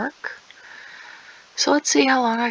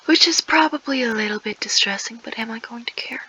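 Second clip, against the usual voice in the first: whisper. Acted. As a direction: whisper it like a secret aside. Long whispered aside.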